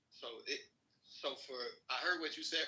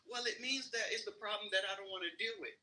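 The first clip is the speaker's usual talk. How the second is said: higher, lightly voiced mother quote; imitating her mother; 9 semitones higher than usual. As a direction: quote her like an anxious mom. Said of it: "And then she say".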